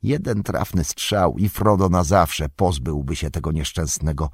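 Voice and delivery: ordinary delivery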